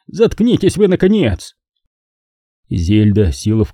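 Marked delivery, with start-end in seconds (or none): 0.1-1.5 harsh order. A narrator's reading. Courtney snaps at Nina.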